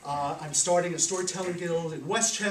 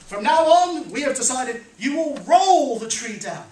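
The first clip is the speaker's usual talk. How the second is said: higher and much louder, a proud proclamation; pompous wise man; 6 semitones higher, 10 dB louder than usual. Acted. Wise old man proclaiming to the workers, loud and pompous. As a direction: announce it like a grand decree. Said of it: "the wise old man said".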